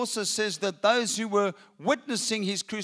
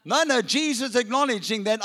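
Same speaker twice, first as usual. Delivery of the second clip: ordinary talk, nothing marked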